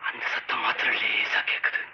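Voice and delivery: creeping campfire horror voice; hushed suspense tale. Acted. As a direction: pull him into the dark, step by step. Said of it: "ஒரு suspense கதை, மர்மம்".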